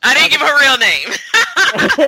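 high, loud protest through laughter; laughing protest. Real, not acted. Friend excusing a slip with a child's name, laughing. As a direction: crack up while defending yourself.

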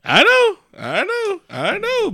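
squeaky, repeated brush-off; high, insistent fending off of teasing; high-pitched protest. Real, not acted. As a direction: cut in with a very high voice. Cuts off co-host's teasing.

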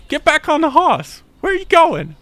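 high, loud, slow shrieking woman; mock outraged NPC screech; shrill demanding woman. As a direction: squawk at him to get back on the horse. NPC woman yelling at player.

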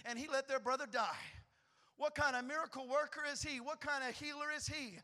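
ordinary talk, nothing marked

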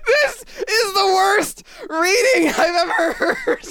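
helpless slow laughing groan, high-pitched; laughing hard. Real, not acted. Listener reacts to awful reading, cracking up. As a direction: squeeze the words out through real laughter.

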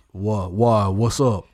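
low-pitched big tough guy voice; deep, imposing bouncer impression. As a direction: drop the pitch for a huge linebacker. Voicing huge bouncer dude.

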